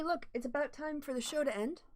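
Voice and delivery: ordinary talk, nothing marked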